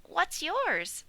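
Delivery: high little piglet voice; bright squeaky young pig. Acted. Piglet answering the old sheep, high piglet voice.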